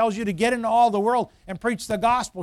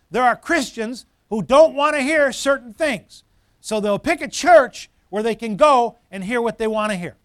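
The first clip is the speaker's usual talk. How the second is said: forceful preaching; 2 semitones higher, 6 dB louder than usual. Real, not acted. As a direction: drive it home to the congregation.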